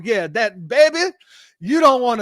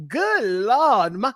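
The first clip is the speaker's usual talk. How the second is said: put-on Southern belle accent, pitched higher; mock Georgia-belle accent with swooping pitch; imitated Georgia accent; 4 semitones higher, slower than usual. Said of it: "she be doing this accent".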